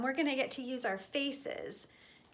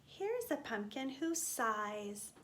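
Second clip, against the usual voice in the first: usual speaking voice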